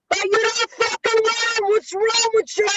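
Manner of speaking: high, drawn-out New York impression; Carmine's New York accent. Friend retelling another WhatsApp message, slow mock New York accent. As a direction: stretch it out as an exasperated, high-pitched New Yorker. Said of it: "like in the New York".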